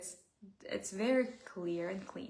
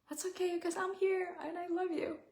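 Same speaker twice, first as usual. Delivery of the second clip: real tears, higher, breaking on the words; crying tenderly; 10 semitones higher than usual. Real, not acted. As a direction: let the crying come through the comfort. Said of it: "brings up so much emotion for me".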